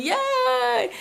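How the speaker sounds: very high, held mock yell; long high cheer; gleeful yelling. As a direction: reenact a squealed cheer without full volume. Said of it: "And I'm yelling".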